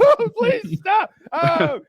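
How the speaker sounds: very high, slow, hammed-up pleading; mock crying like a little girl. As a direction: wail like a frightened little girl. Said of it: "The man's crying like a little girl".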